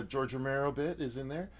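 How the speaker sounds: ordinary delivery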